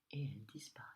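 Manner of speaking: ordinary delivery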